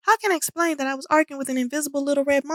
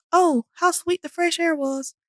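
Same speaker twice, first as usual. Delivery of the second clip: ordinary delivery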